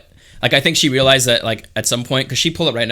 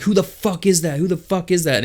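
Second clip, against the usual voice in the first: mock angry demand; 5 semitones higher than usual. Girlfriend demanding answers over the phone, mock angry. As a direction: imitate an irate girlfriend on the phone.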